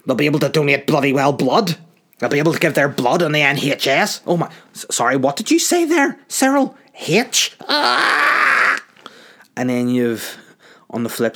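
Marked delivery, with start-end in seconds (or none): 0.0-4.2 indignant mock rant, loud and rising; outraged politician. Mocking the DUP with an outraged, raised voice. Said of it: "they lose their shit". 4.3-8.8 shrieking high, far louder, ending in a cry; mock shriek. Pretend politician shrieks at Cyril in mock horror.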